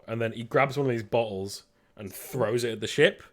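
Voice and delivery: ordinary talk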